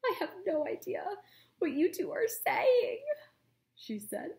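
high, loud, teary voice; tearful old doctor. Acted. Doctor Malovo, crying, admits she understands nothing. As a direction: laugh through sniffles.

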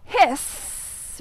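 a long unvoiced hiss; breathy snake hiss with no voice. Shown as a demonstration. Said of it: "we know snakes go".

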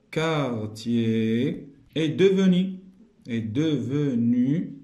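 slow, word by word; drawn-out dictation. Shown as a demonstration. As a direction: dictate slowly for the class. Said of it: "dictating answer".

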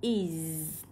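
"is" kéo dài âm z rung; âm đuôi /iz/. Shown as a demonstration. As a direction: stretch "is" into a buzzing iz. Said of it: "sẽ được phiên âm là âm". (đọc "is" rõ âm iz)

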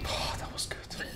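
soft unvoiced whisper. Real, not acted. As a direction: whisper it to yourself. Quiet aside during match.

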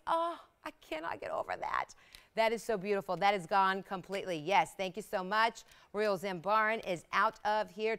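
ordinary delivery

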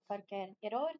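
ordinary delivery

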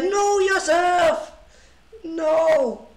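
high, loud, drawn-out "no"; mock outcry. Streamer addressing a viewer's electricity trouble, mock outcry. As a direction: wail it in exaggerated protest. Mock scolding after pleading with Lichess.

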